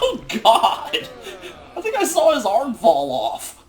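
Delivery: high, loud laughing words; laughing hard. Real, not acted. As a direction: lose it laughing through the line. Laughs at a glitchy animation.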